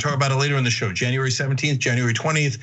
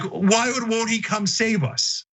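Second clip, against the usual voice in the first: mock furious yell; 7 semitones higher, slower than usual. Furious senator asking why Trump won't save them, mock indignant yell. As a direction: lightly mimic an outraged, pleading yell. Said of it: "furious and yelling".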